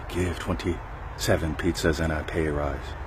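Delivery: slow, breathy whisper; whispered demo. Shown as a demonstration. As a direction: whisper it slowly into their ear. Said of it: "imagine whispering in their ear".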